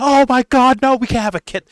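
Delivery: high, loud mock panic; shrill mock horror at the idea; panicked parent. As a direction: squeal it like a horrified parent. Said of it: "You'd be like".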